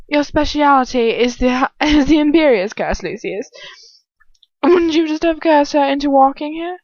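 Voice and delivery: demented English voice, high and loud; Bellatrix. Acted. Scolding Lucius in the hallway, demented and high. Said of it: "Bellatrix greets us".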